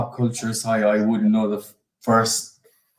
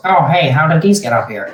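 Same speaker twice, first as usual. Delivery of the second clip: higher, louder mock Peter; Peter Griffin voice; 4 semitones higher, 6 dB louder than usual. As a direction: blurt it like a surprised cartoon dad. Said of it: "Peter ... just goes".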